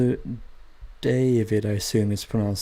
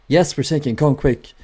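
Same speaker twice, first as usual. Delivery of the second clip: higher, louder hammed-up panicked reply; mock panic; 4 semitones higher, 6 dB louder than usual. Sinking ship crew answering the radio, hammed-up panic. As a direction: play a sinking crew begging for help. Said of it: "They were like".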